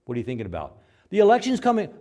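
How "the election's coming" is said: high, loud fretful voice; anxious churchgoer fretting, high and loud; worried believer. Acted. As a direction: blurt the worry out high. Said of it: "anxious believer voice".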